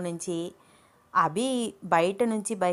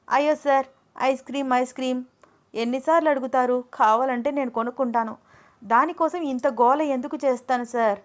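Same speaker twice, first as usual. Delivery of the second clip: Ananya protesting; 5 semitones higher than usual. Acted. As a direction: push back, half laughing at him. (విసుగుతో ఎత్తైన గొంతు నిరసన)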